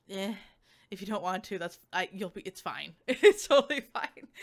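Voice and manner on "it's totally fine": laughing. Real, not acted. Laughing off her own hedging.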